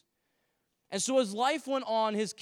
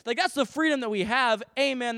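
ordinary delivery

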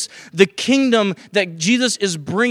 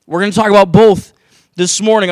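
loud emphasis; 8 dB louder than usual. Real, not acted. Preacher stresses point to congregation.

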